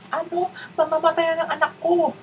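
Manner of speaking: very high, squeaky mock alarm; mock-horrified parent. Parent hearing the cable must go, mock-horrified high voice. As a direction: overplay a parent's panic in a high put-on voice.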